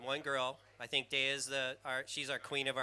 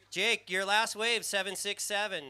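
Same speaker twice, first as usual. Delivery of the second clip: loud announcer call; 6 semitones higher, 6 dB louder than usual. Real, not acted. Commentator announcing score over PA.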